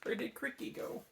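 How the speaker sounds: soft worried whisper. A narrator's reading. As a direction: breathe the question anxiously.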